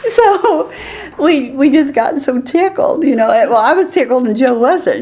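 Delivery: high, laughing through the words; can barely get it out for laughing. Real, not acted. Laughing while telling the story.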